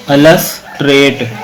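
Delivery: अलस trade split slowly, pause after अलस; two separate chunks. Shown as a demonstration. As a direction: break अलस trade into "alas" and "trate". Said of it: "दो syllable हैं". (अलस trade को दो टुकड़ों में बोलें)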